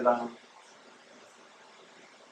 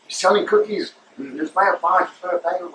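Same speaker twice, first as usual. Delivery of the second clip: imitating shop girl; 6 semitones higher than usual. Girl urges coworkers to buy.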